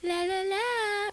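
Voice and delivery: high, slow sing-song swoon; dreamy swooning. Retold or imitated. Kenzie leaning into Corbin, mock dreamy sing-song. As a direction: float off in a daydream. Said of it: "just like".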